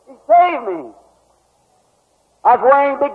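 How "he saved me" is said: ordinary delivery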